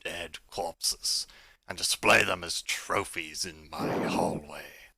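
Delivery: slow, loud, menacing dragon; booming dragon voice. Acted. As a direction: boom it out slowly like a huge beast. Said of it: "booms out loud in the tunnel".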